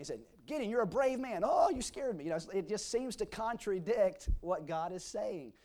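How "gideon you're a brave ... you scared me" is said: startled Gideon. Acted. Acts God then Gideon.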